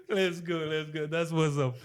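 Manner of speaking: ordinary talk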